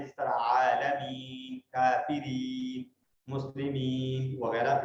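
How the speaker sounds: slow stretched long ee in आलमीन; long ee demo. Shown as a demonstration. Teacher showing students slow stretched ee sounds. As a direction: draw out the ee in काफिरीन slowly. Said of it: "उसको हम या मद्दा कहते हैं".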